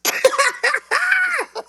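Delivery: high mock laugh of a friend; imitated laugh. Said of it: "And that's the laugh".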